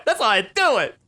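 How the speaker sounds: high, loud, delighted boast; gleeful boast. Real, not acted. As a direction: crow about it happily.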